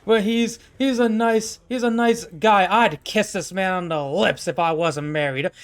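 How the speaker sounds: hammed-up big emotional declaration about a friend; loudly insist, drawing the words out; loud protesting. Acts Thor's drunken, loud reply.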